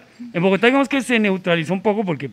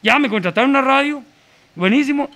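lifted, higher excited announcement; retold burst of delight; excited news; 6 semitones higher than usual. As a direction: break the good news proudly. Retold good news to family.

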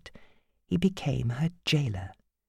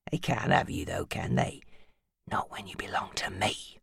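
soft, low, threatening whisper; menacing whisper; 8 semitones lower, 8 dB quieter than usual. A narrator's reading.

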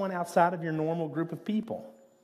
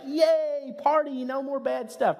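a sudden high, louder "yay, party"; mock cheer; 11 semitones higher, 4 dB louder, slower than usual. Pastor mocking a happy ending for his listeners, high mock cheer. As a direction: cheer like the story is over.

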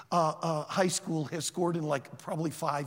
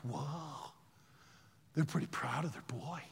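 soft, hushed inner thought; quiet awe; 3 semitones lower, 9 dB quieter than usual. Retold or imitated. Onlooker moved by parents' pride, thinking softly. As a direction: say it low, as if to yourself.

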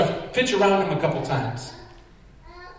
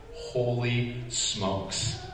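very slow, softer, deflated; stunned quiet disbelief; 6 dB quieter, much slower than usual. Retold or imitated. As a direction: it sinks in that you lost. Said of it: "I'm like".

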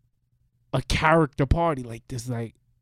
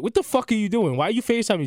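indignant protest; 7 semitones higher, faster than usual. Acted. Acted protest at surprise video call.

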